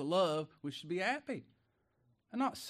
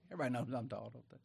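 ordinary delivery

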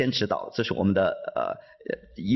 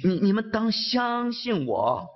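higher, slow earnest plea; Jesus pleading with his disciples, slow and higher; almost pleading; 9 semitones higher, slower than usual. Acted. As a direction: beg them to believe, pitched up. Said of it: "几乎是在恳求他们啊".

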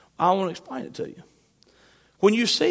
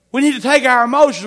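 ordinary talk

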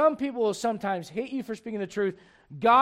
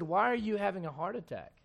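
calm reply; 3 semitones lower, 8 dB quieter than usual. Retold or imitated. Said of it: "And I said".

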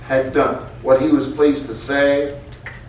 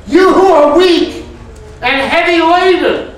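much higher and louder, pleading; ringing preacher cry; 13 semitones higher, 6 dB louder than usual. Acted. Preacher calling out the Lord's promise, high and loud. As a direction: call it out to the weary.

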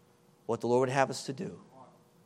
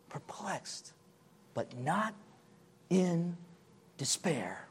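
hushed whisper of the verse; softer whispered repeat; 2 semitones higher, 7 dB quieter, much slower than usual. Acted. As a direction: breathe the words like a secret. Repeating Paul's words softly.

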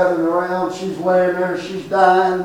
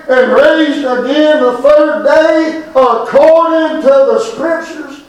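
preaching; 6 semitones higher than usual. Acted. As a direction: proclaim it to the congregation. Preaching the gospel.

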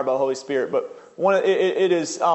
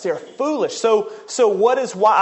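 ordinary talk

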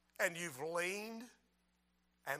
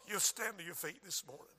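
normal talking voice

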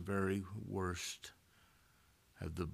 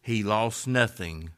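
ordinary delivery

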